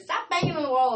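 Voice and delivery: real irritated shout-out; annoyed call-out. Real, not acted. Noise from neighbors next door.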